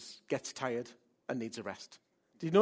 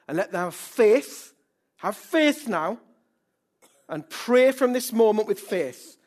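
loud, declaimed prayer with raised pitch; fervent prayer; 5 semitones higher, 8 dB louder than usual. Real, not acted. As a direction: pray boldly, pressing each phrase. Leads congregation in prayer.

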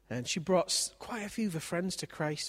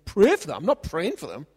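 put-on loud, outraged teenage protest; indignant refusal; 14 dB louder than usual. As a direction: bristle at the very idea. Daughter refuses to pray for enemies.

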